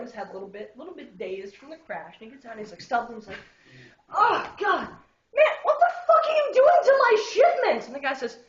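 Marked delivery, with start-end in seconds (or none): 4.1-7.9 high, loud indignant yelling; outraged shout. Retold or imitated. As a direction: yell at him for wrecking your cargo. Angry driver confronts the guy.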